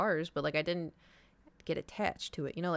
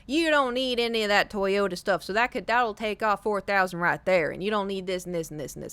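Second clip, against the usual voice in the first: put-on Texas accent. Mom's boyfriend picking apart the paperwork, Texas accent. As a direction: talk like a Texan car adviser. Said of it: "very Texas".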